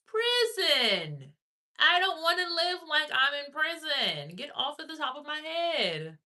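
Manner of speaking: high, loud, stretched-out words; high-pitched indignant bellow; furious drawn-out yell. Acted. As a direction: holler it slowly, each word dragged out. Acting out angry protest to ex.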